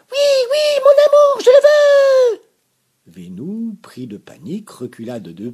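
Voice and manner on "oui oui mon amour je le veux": joyful scream. A narrator's reading. Sophie throws herself on Vénou, shrieking joy. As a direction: scream yes in ecstasy. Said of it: "Elle hurla de bonheur".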